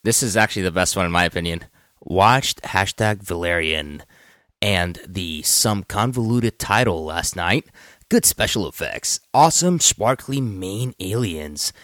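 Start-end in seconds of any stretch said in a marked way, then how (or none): 2.0-11.7 very slow, deliberate tweet reading; Will Arnett. Acted. Co-host reads a fan's mini review, slow put-on voice. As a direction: draw out each phrase in a lightly impersonated actor voice. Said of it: "Will Arnett voice".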